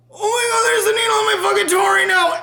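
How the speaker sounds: high-pitched, strained inner squeal; mock panic. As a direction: act out silently freaking over a needle. Acts inner panic at first needle.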